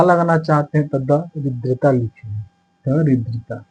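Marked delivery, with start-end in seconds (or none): none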